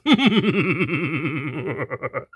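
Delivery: mocking villain laugh; a thug's gloating laugh. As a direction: laugh at the captive. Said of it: "said with a laugh".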